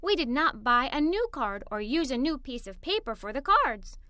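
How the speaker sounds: ordinary delivery, nothing marked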